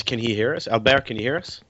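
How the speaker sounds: ordinary talk, nothing marked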